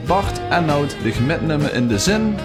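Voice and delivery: ordinary talk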